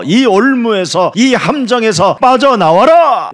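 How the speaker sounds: rising preacher's cry; forceful command. Acted. Preacher calling listeners out of the trap, forceful command. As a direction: build to a loud order on the last word.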